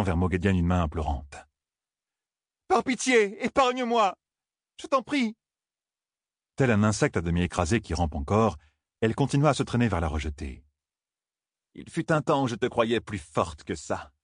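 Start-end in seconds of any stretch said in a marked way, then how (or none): 2.7-5.4 very high desperate pleading; begging for mercy. A narrator's reading. 11.7-14.1 contemptuous villain. A narrator's reading. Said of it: "lâcha Mogedienne".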